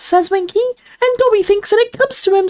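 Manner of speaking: very high, squeaky house elf. A narrator's reading. Dobby retells their job hunt, squeaky elf voice. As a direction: keep it all in a piping elf squeak. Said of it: "this is all elf voice".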